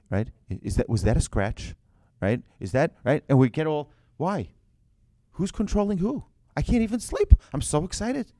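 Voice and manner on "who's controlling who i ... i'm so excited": giddy new owner. Acted. Acting out new car excitement.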